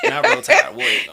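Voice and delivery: rally the crowd against the rival; rowdy team cheer. Acted. Auburn fan mocks the rival cheer, then shouts her own.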